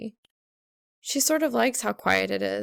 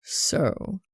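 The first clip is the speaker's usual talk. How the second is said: drawl; 4 semitones lower than usual. A narrator's reading. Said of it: "he drawls".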